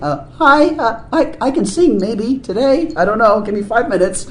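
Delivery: high, hesitant, halting mock timidity; imitated stage nerves. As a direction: act out a flustered performer asking for time. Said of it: "it's frightening. It's frightening, it's disconcerting".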